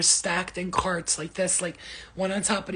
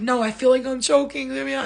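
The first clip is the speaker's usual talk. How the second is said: higher, louder pleading to get out; panicked plea; 6 semitones higher, 7 dB louder than usual. Retold or imitated. Answering the MRI tech, panicked plea. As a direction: beg like you can't breathe. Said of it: "I was like".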